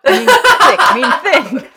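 high, loud, laughing through words; cracking up mid-sentence. Pretend. Flustered order collapses laughing.

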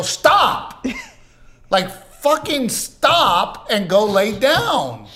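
sharp high yelled stop; retold yell. Husband yelling at his tired wife to stop. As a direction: snap it out loud and high.

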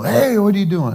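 high, cheerful big-brother greeting; jovial brother. Retold or imitated. Older brother cheerfully answers the phone, high and jovial. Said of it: "he's always jovial".

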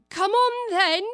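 high, loud, slow royal command; bossy queen voice. Acted. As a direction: boom it out like an imperious queen. Queen orders Alice.

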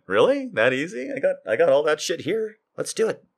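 high, eager mock disbelief, then game; surprised delight. Host retells reacting to the ketchup recipe, high surprised glee. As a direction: pitch way up, thrilled it's so simple.

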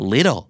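"Little" said like "liddle"; American flap T. Shown as a demonstration. Teacher modelling the word for learners, flap T. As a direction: tap the T in "Little" as a D. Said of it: "ออกเสียงเหมือน L I D D L E".

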